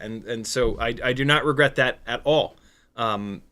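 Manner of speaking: ordinary talk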